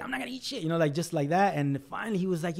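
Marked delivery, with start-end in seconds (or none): 0.0-0.6 put-on high, sulky kid refusing; high-pitched bratty kid voice; stubborn kid. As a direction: whine it back like a stubborn child who won't eat. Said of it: "a kid who's just like".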